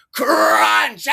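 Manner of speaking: long drawn-out, loud high CRUNCH; acted-out crash. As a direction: yell it like the impact itself. Imitates crashing onto ice.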